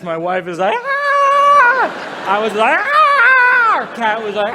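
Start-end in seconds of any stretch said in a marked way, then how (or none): 0.8-2.3 high, loud drawn-out "aah"; mock scream. Comic imitates wife's reaction, high drawn-out scream. 2.8-3.8 long, high, very loud "aah"; mock scream. Comic re-enacts his own reaction, loud high scream.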